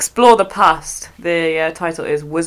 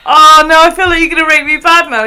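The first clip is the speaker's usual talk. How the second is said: dismayed; 8 semitones higher, 7 dB louder than usual. Real, not acted. Fears a bad rating.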